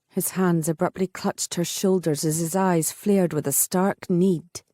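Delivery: usual speaking voice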